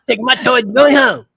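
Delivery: high, loud petulant voice through laughter; mock sulky child. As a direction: join in the joke as a huffy kid. Joining the sulky quitter joke, laughing.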